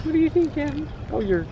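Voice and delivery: high-pitched sweet talk to a pet; dog talk. Real, not acted. Owner asking her dog's opinion, high dog talk. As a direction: ask the dog in a high little voice.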